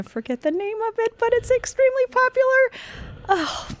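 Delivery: rising into a high, flustered squeak. Real, not acted. Forgetting the show's name on a podcast, high flustered squeak. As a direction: voice climbs high as the name slips away.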